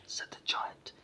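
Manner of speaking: plain reading voice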